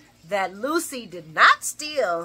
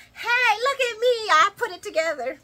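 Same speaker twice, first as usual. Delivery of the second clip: mocking show-off; 12 semitones higher, 3 dB louder than usual. Vlogger mocking a Rainbow-clothes wearer, high and preening. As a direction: parody someone proud of a loud outfit.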